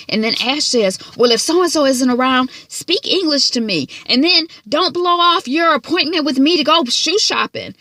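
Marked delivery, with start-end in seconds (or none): 1.2-7.8 higher and louder, mock outraged scolding; imitating an angry scold. Vlogger mimics Ash scolding a child on the phone. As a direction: ham up the outrage at a little girl.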